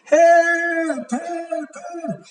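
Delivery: high, drawn-out cry, fading away; shouting for help. Acted. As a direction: squeal for help, then let it die away. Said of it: "she shouted for help".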